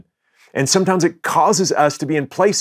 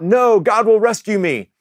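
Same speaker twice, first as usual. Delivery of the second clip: high-pitched mock holler; calling out; 8 semitones higher than usual. Stranded man turns down the ship, calling out. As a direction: retell his refusal as a high, lightly played call. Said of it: "the man calls out".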